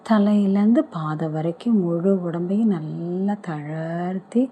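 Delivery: usual speaking voice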